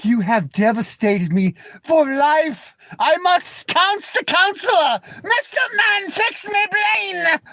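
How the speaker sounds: high, slow, pleading mock whine; high slow whimpering voice, mocking; mock heartbroken whiner. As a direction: ham up a wounded crybaby begging. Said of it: "He's like".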